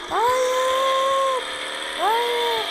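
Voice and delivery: panicked high shout of no. Real, not acted. Gamer panicking at the screen, loud shout. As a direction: yell it in alarm.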